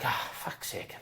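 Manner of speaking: whispered fed-up curse under the breath; muttered. Retold or imitated. Benched player cursing under his breath, whispered. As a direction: grumble it to yourself. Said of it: "So I was like".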